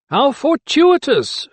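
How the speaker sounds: high, slow, drawn-out relish. A narrator's reading. Magistrate greeting an unwelcome doctor, with relish. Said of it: "touch of relish in the magistrate's tone".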